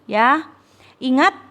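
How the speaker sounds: ordinary delivery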